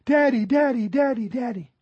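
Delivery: high, loud imitation of a little boy calling; excited child calling. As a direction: cry out like a child running to a parent. Acts out son running to him.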